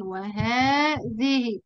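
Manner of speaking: وهذه stretched very slowly, long aa after the ha; drawn-out demo word. Shown as a demonstration. Teacher listing demonstratives for students, drawn out slowly. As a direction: sound out وهذه slowly with the hidden alif. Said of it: "وإيه كمان؟".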